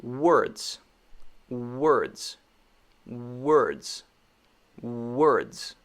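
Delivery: "Words" with lips releasing the W. Shown as a demonstration. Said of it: "releasing the W sound".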